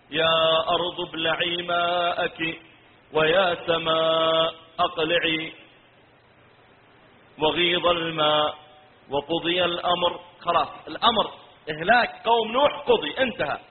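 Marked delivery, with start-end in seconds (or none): 0.1-10.2 slow melodic tilawa; Quran recitation. Acted. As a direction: recite slowly, drawing out the long vowels. (تلاوة قرآنية مرتلة)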